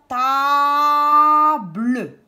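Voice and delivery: TABLE as a loud, long TAA, then BLEU; drawn-out syllables. Shown as a demonstration. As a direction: hold the A of TABLE, sound the final E. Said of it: "Est-ce que j'entends A".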